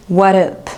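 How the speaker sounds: WHAT UP run together without the S; "what up" as casual American slang, consonants swallowed; slangy swallowed S. Shown as a demonstration. Teacher showing learners how Americans shorten it, slangy swallowed S. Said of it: "ça va des fois sonner comme".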